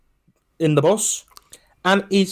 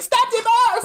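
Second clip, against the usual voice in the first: much higher, imitating a panicked girl's cry; frantic girl yelling; slower than usual. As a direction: squeal it out like a distressed young woman. Girl screams for driver.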